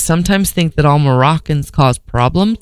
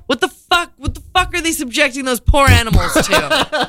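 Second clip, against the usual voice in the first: much higher, incredulous outburst; can't believe they'd do that to dogs; reacting to police dogs, high disbelief; 9 semitones higher than usual. Real, not acted. Outraged for the dogs.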